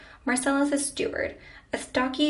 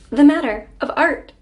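louder, teasing reply with a sly smile; mischievous; 3 semitones higher, 9 dB louder than usual. A narrator's reading. Woman teasing the flustered critic, mischievous. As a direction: toss it back playfully, punched up. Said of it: "with a mischievous smile".

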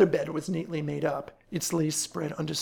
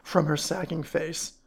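usual speaking voice

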